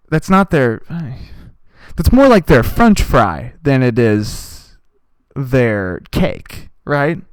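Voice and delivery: usual speaking voice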